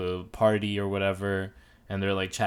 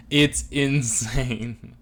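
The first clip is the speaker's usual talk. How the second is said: normal talking voice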